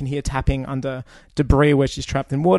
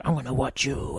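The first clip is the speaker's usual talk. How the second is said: softer, put-on Batman rasp; terrible Batman; 5 dB quieter than usual. Mocking Batman threatening Lex in the cell, put-on rasp. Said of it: "It's terrible Batman".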